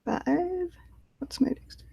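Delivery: soft unvoiced aside; whispering. Real, not acted. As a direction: whisper it to yourself.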